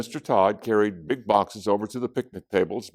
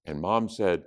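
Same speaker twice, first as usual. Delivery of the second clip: ordinary delivery, nothing marked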